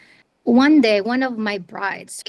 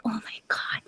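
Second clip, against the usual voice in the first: whispered "oh my God" to herself; whispered dismay; 6 dB quieter than usual. Retold or imitated. Realizing audio editing is needed too, whispered dismay. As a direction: hush it like a private panic.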